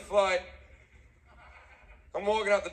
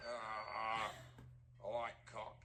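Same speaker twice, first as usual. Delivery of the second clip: deadpan admission; 11 semitones lower, 17 dB quieter, slower than usual. Retold or imitated. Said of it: "Fucking yeah".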